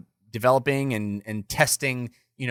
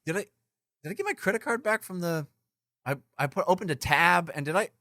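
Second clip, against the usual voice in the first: normal talking voice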